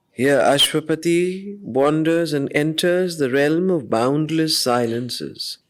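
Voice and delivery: usual speaking voice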